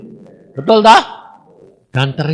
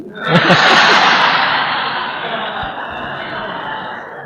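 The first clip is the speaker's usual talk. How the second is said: 1 breathy laugh; 7 semitones higher than usual. Real, not acted. Laughs after the mock whine.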